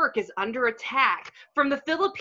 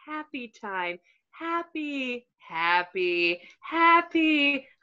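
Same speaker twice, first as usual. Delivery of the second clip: soothing hypnotic; 4 semitones lower than usual. Acted. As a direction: coax him into calm like a spa tape. Brainwashing video soothing voice.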